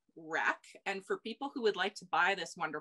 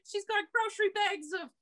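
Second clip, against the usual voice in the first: much higher, calling to Andy in disbelief; excited reading outburst; 13 semitones higher, 4 dB louder than usual. Retold or imitated. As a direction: squeal it out while reading aloud to a partner. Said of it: "I was just so verbal as I was reading it".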